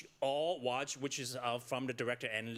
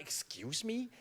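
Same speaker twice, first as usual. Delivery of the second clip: mock shocked parents; 8 semitones higher, slower than usual. Parents hearing she chose theater, mock shocked. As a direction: act the parents' disbelief. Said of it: "they were like".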